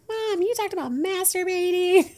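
high, laughing mock kid voice; imitating her kids. Kids teasing their mom, high laughing mimic. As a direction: play the teasing kid, giggling. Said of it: "my kids will ... be like".

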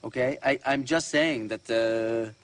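put-on Italian accent, hesitating; faltering mock-Italian accent; Tucci accent. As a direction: defend yourself in a hammy Italian accent. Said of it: "that was a Tucci accent".

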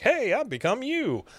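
cheerier rephrasing. Retold or imitated. Hank showing Luann a nicer way to say it, high and cheery. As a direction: lift it way up, as if it were good news. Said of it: "could have said it more like".

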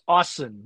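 Osen pronounced AH-sen; open first vowel. Shown as a demonstration. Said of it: "I'm saying it's".

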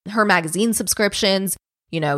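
ordinary talk

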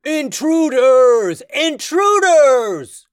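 high, loud, drawn-out alarm cry; booming shout. Acted. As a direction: boom the alarm across a huge chamber. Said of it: "a booming voice echoed through the chamber".

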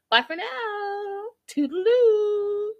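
very high, slow, lilting goodbye; sing-song sign-off. Pretend. Host signing off to her viewers, sing-song. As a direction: trill a drawn-out playful farewell.

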